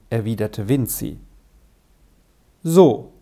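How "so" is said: a short, curt "So". A narrator's reading. Father answering his son's earnest wish, curtly. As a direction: cut it off firmly, slightly louder. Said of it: "sagte der Vater kurz".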